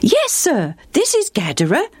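put-on high, bright young boy voice. A boy cheerfully answers the stranger, high and bright. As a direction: answer like an eager little boy. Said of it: "the boy answered brightly".

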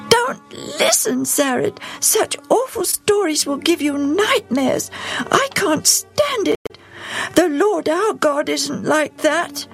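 put-on high, indignant child's voice; upset girl. A narrator's reading. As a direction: protest as an upset little girl. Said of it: "Evie was angry".

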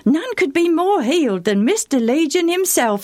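put-on a cheerful boy helping a traveller; eager boy. Boy tells Legion's past.

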